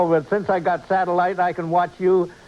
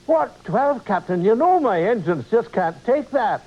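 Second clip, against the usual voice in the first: higher-pitched Scottish engineer's protest; Scotty; 4 semitones higher than usual. Pretend. Starship engineer answering Captain Kirk's call, in a Scottish accent. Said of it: "Instead of hearing the familiar".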